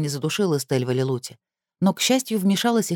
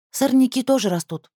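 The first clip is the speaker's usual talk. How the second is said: complaining; 8 semitones higher than usual. Acted. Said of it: "пожаловалась та Кассандре".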